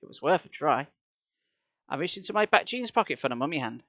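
usual speaking voice

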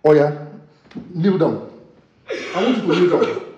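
menacing husband. Acted. Husband orders wife to kneel.

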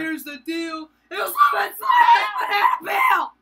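loud, high announcer shout; excited shout. Pretend. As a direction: yell it like an announcer.

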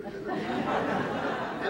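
words half swallowed by a laugh, slow and airy. Real, not acted. Reader cracks up retelling Kriska's joke, laughing. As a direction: laugh through it as you repeat the joke.

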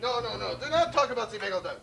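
ordinary talk

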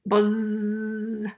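long, steady buzz on one note; bee buzz. Acted. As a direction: drone on like a bee. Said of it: "Then the bee said".

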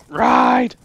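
ordinary delivery

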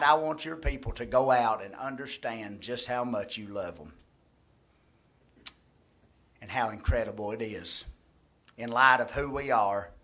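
earnest pastor's prayer, steady and sincere; closing prayer. Acted. Pastor praying over the congregation, earnest and steady. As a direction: pray it aloud for the whole room.

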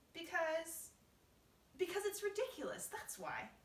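high, loud protest; exasperated insistence. Acted. James arguing with the grasshopper, high exasperated insistence. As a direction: insist loudly, flustered and high.

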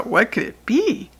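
plain reading voice

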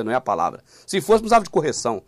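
ordinary delivery

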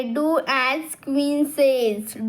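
put-on very slow, word by word, like reading to children. Shown as a demonstration. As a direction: spell out "Do as queen says." slowly for kids. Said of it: "Do as queen says म्हणजे काय?".

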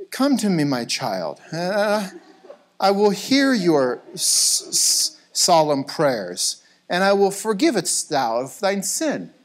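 hammed-up, slow clerical pronouncements; mock solemn priest. Pastor parodies a priest hearing prayers, mock solemn. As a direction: intone like a pompous holy man, swooping in pitch.

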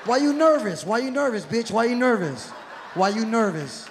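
loud taunting. Acted. Comic taunting the nervous passenger, loud jeer.

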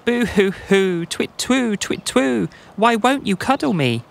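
high-pitched hooting boo-hoo; hammed-up, high-voiced pleading; mock sobbing owl. A narrator's reading. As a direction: wail like a storybook crying owl. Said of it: "sobbing in his tree".

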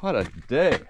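ordinary delivery

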